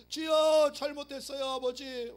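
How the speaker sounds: hammed-up loud repentant plea; mock pleading prayer. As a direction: beg forgiveness at full voice.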